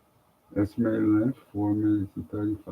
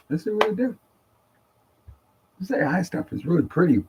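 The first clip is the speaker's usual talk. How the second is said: high playful voice; 10 semitones higher, faster than usual. Pretend. Playful high voice while testing tool.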